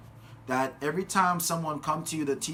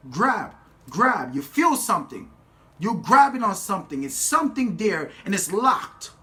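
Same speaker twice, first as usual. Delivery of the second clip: louder, higher motivational exhortation; forceful, raised-voice coaching; emphatic preaching; 5 semitones higher, 6 dB louder than usual. Real, not acted. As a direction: urge the crowd on, punching each short phrase. Urges audience through grip demonstration.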